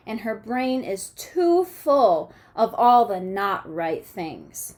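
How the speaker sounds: expressive picture-book read-aloud; reading to children. A narrator's reading. Teacher reading a storybook to her class, lively and expressive.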